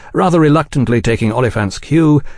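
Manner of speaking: plain reading voice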